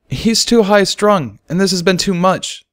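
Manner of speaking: a mare explaining the colt's panic attack; calm diagnosis in a mare's voice. Acted. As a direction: give the verdict plainly, looking down. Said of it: "she explained".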